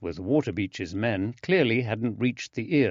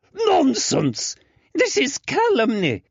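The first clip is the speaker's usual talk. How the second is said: outraged; 5 dB louder than usual. Acted. Father outraged denial.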